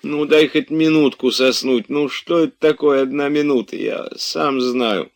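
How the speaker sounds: high-pitched sleepy begging; pleading. A narrator's reading. Master begging servant for a minute's sleep, pleading.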